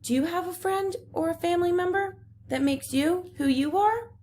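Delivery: ordinary talk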